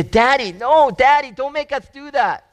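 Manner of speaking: put-on high, begging child's voice; pleading children. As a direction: plead like a scared kid. Kids beg father not to.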